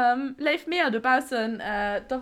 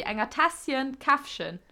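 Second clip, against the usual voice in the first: ordinary talk, nothing marked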